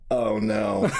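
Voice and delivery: a retold gasp of dread as the ball flies; horrified. Kicker watching the ball fly toward the girl, mock horrified. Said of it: "I was just like".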